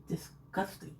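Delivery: unvoiced disgusted whisper. Real, not acted. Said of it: "my apologies".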